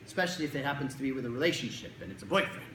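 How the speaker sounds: ordinary talk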